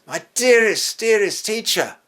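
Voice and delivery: a cry of sudden joy; overjoyed Mary. Retold or imitated. Mary's joyful recognition.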